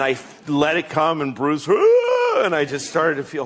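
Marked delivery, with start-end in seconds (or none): 1.7-2.4 high, held ah; sung rock wail. Retold or imitated. Imitating [name]'s wail in the song, high held cry. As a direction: belt it like the song hits.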